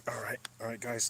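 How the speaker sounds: soft whispered aside; hushed whisper before a joke. Real, not acted. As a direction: whisper it to draw listeners in. Hushed before mock sponsor line.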